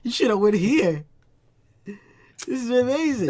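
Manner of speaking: loud, high-pitched excitement; thrilled. Real, not acted. Telling a friend they missed Popeyes, thrilled outburst. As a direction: gush loudly over the discovery.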